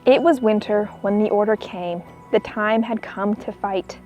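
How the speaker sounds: ordinary delivery, nothing marked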